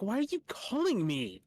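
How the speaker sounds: high, loud fed-up whine; mocking the aunt's pointless call, exasperated. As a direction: whine it like you're sick of the calls.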